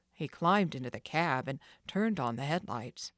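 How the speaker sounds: ordinary delivery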